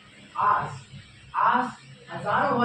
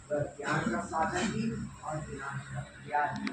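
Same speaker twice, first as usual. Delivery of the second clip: ordinary talk, nothing marked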